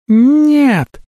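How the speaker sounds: piercing high loud shriek. A narrator's reading. Talli shrieks as Zovan catches fire, piercing and high. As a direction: squeal in horror. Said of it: "взвизгнула Талли".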